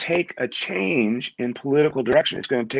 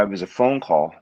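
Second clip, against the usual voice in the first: ordinary talk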